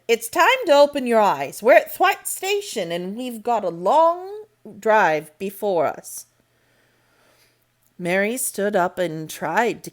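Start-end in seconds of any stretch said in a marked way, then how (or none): 0.1-6.3 put-on louder, higher, rousing a sleepy child; brisk raised voice. A narrator's reading. Medlock shaking Mary awake at the station, brisk and loud. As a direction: call her awake, brisk and firm.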